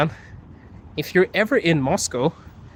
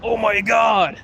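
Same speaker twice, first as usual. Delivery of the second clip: scandalised cry, higher and with a shout; mock shock; 5 semitones higher, much slower than usual. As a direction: act appalled at the accusation. Mock shock at being accused of stealing.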